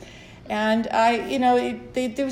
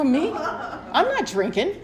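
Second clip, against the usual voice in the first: incredulous laugh; 6 semitones higher, much slower than usual. Retold or imitated. Answering the Al-Anon suggestion.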